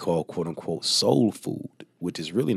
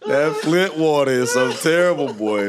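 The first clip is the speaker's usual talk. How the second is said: much higher and louder, cracking up; laughing outburst; 11 semitones higher, 7 dB louder than usual. Real, not acted. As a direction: blurt it out in disbelief. Laughing outburst about Flint.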